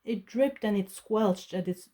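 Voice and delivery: usual speaking voice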